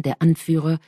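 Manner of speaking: usual speaking voice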